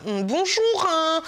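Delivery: high, sing-song fake-sweet bonjour; mocking girly voice. Mocking the girls who talk to her in a high prissy voice. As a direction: parody a prissy girl greeting you. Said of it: "J'aime pas les filles qui me parlent comme ça".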